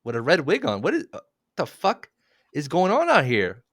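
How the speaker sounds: freaked out. Pretend. Pretend horror scene in basement.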